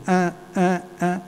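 short playful mock grunts; teasing grunts. Boy blocks his classmate in the hallway, playful mock grunts. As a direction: imitate a boy fooling about blocking the hallway.

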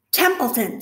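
screaming. Acted. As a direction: Wilbur screams to wake the rat. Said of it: "screamed Wilbur".

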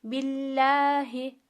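slow recitation with "Billahi" and Allah in thin, light tone. Shown as a demonstration. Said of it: "ince okuyoruz". (Allah lafzı ince, yavaş tilavet)

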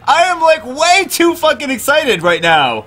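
very high, giddy squealing. Real, not acted. Gamer to viewers on the five star, giddy squealing. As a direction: bubble over with glee.

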